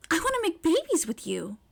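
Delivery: high, loud eager declaration; eager Mei voice. A narrator's reading. Mei bluntly declares her intent.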